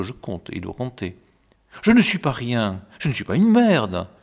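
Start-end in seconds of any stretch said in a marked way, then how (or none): 1.8-4.1 loud, defiant insistence on self-worth; forceful boast of not being nothing; proud loud claim. Acted. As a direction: declare it proudly, almost vain. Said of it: "c'est prétendre fortement, presque avec orgueil".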